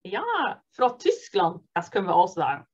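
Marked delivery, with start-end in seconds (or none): none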